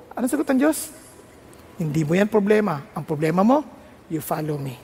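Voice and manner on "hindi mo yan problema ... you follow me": low, unhurried divine answer; God's calm reply. Acted. God answering a worried future minister, low calm authority. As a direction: drop low and settle the matter.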